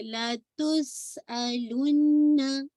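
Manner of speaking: लतुस अलुन्ना with hissed स and held double न; slow whistling recitation. Shown as a demonstration. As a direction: recite लतुस अलुन्ना slowly, lingering on the hum. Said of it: "whistling sound आ रही है". (लतुस अलुन्ना धीरे, स में सीटी, न में गुन्ना)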